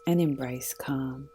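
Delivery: calm, unhurried meditation guide voice; guided meditation. Acted. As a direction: lead them gently into stillness.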